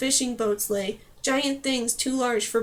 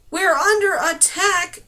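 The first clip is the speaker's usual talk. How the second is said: loud, higher, slow yell; 6 semitones higher, 7 dB louder, slower than usual. A narrator's reading. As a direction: shout the alarm back at her. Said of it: "Bury yelled".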